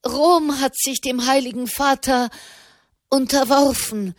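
overjoyed blurt; bubbling out. A narrator's reading. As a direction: let it spill out in one rush. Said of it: "sprudelte Malherbe heraus".

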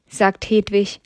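usual speaking voice

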